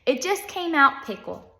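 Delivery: testy self-correction; snapped. A narrator's reading. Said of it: "snapped Missus Jules".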